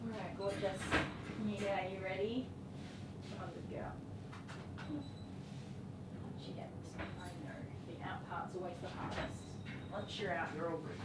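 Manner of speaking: hushed, gentle coaxing of a nervous dog; soft coaxing. Real, not acted. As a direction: softly talk the dog out of the bath.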